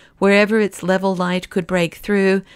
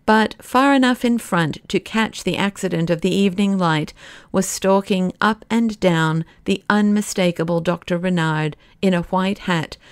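ordinary delivery

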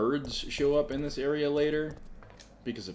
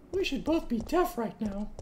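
high put-on voice; mimicking voice; 12 semitones higher than usual. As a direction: parrot the character, pitched way up. Mimicking the character's line.

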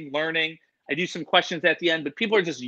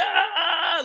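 mock panicked yell. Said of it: "I was like".

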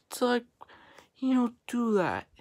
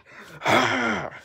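loud exasperated groan; frustrated growling sigh; 3 semitones higher, 5 dB louder than usual. Real, not acted. Vlogger venting about being told to chill, exasperated growl. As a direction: let out a forceful growl of frustration.

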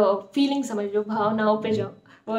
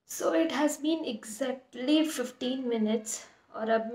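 stiff lips under a wet face mask; limited facial movement while talking; speaking with a sheet mask on; 3 dB quieter than usual. Real, not acted.

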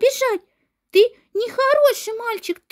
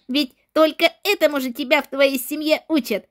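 normal talking voice

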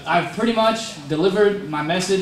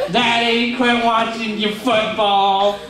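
mock crying child; 5 semitones higher, slower than usual. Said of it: "I was crying like a baby".

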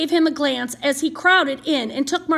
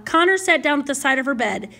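usual speaking voice

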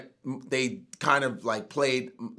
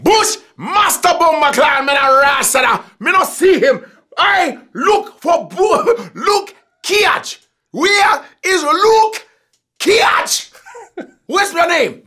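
much higher, loud, slow hammed-up Jamaican accent; mock Jamaican accent; 9 dB louder, slower than usual. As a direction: shout it in an exaggerated Jamaican accent. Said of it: "doing different Jamaican accent".

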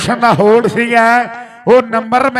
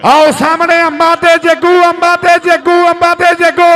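excited call-out; 5 semitones higher than usual. Real, not acted. Commentator calling out the raid.

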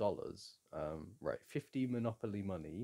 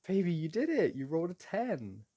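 high, loud delighted cheer; excited cheer; 8 semitones higher, 7 dB louder than usual. Real, not acted. Cheering her partner's lucky dice roll, excited.

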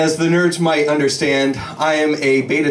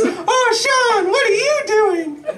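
squawking bird looking down at Sean; slower than usual. Acted. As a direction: play the bird calling down from the tree in a shrill-high cry. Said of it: "It says".

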